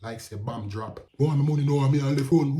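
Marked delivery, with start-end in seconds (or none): 1.2-2.6 loud retold shouting; top of his voice. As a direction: bellow it for the whole hill to hear. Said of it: "On the top of his voice".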